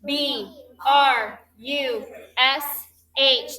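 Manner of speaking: slow, even letter-by-letter spelling; spelled letter by letter. Shown as a demonstration. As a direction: call out each letter slowly for the class to join. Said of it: "let's all spell together the base word".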